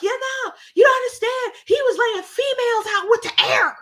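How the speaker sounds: mock a girl defending the rioters; imitating the girl. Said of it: "that's how she sounded".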